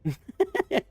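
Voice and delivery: a high, giddy burst of laughter. Real, not acted. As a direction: laugh at the absurdity. Laughing at own story.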